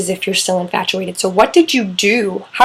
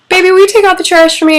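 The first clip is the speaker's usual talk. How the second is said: higher, louder and fast, a mock wife voice; wife asking; 10 semitones higher, 6 dB louder, faster than usual. The wife asking her husband to take out the trash, mock high voice. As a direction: play the wife pleading sweetly. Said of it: "she says".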